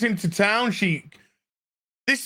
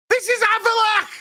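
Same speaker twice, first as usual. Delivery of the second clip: outraged disbelief; 12 semitones higher, 3 dB louder than usual. Real, not acted. Outraged reveal of casting.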